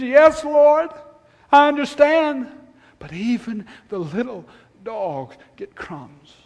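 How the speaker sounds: pleading high and loud, then dropping back; retold desperate appeal, easing off at the end; desperate plea. Preacher voicing the woman begging Jesus for crumbs, desperate. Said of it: "in her desperation".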